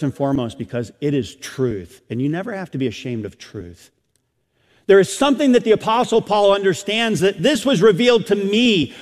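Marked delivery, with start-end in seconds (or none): 4.9-8.9 higher, louder pulpit delivery; full-force preaching. Real, not acted. Preacher urging the congregation to see daily life anew, impassioned preaching. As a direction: preach it out high and driving.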